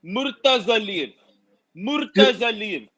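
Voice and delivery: Murtazaliyev said slowly, moor-tuh-za-leev; slow pronunciation. Shown as a demonstration. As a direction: drill Murtazaliyev slowly twice. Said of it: "give you an example by pronouncing Khalid's last name".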